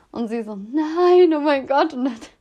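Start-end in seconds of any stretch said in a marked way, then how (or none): 0.6-1.9 surprised outcry. Retold or imitated. Friend reacts to the news.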